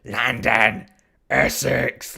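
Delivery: hammed-up London and Essex accent squabble; mock London-Essex accents. Two TV guys arguing over their accents, mock accent bickering. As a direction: bellow place names in exaggerated southern English accents. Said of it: "they're just, like, going ... arguing over, like, their accent".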